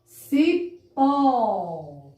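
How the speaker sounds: "Cipó" split in two, loud long open pó; stressed open syllable. Shown as a demonstration. Teacher shows the open O, stretching "pó" in "Cipó". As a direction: break "Cipó" apart and hold the ó. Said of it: "tem um som mais aberto".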